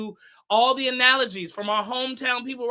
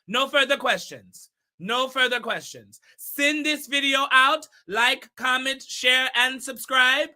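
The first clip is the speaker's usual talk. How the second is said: measured imitation of Shaba's accent, word by word. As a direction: declare it emphatically in Shaba's accent. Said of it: "keep this accent all night".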